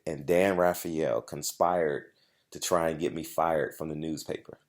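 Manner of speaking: normal talking voice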